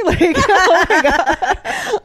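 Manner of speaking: high, slow laugh through words. Real, not acted. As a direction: laugh out loud, drawn out. Laughing at the memory.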